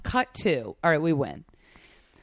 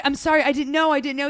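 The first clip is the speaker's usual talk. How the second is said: high, louder pleading apology; retold flustered apology; 6 semitones higher, 4 dB louder than usual. Embarrassed passenger apologizing to driver, high and flustered. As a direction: reenact apologizing over and over. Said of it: "Did you apologize?".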